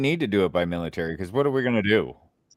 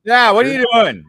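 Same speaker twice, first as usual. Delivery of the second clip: excited shout; 12 semitones higher, 8 dB louder than usual. Real, not acted. Laughing outburst at caller.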